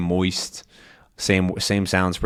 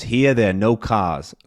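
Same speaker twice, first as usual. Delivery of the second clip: R-less New York demonstration; New York accent. Shown as a demonstration. Teacher showing what the phrase becomes, New York accent. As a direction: drop every R like a New Yorker.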